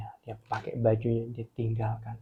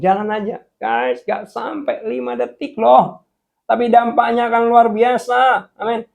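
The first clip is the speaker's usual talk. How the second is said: loud, high exhortation building in intensity; full-force preaching; 10 semitones higher, 10 dB louder than usual. Real, not acted. Preacher urging the congregation to rely on God, impassioned. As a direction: drive each item home, climbing higher and louder.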